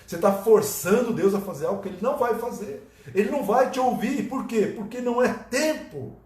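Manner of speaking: high, forceful preaching; rising preaching. Acted. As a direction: build the point up hard.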